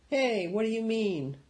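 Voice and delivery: high, mock-offended protest. A narrator's reading. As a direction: bristle at the joke. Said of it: "Patrick looked up angrily".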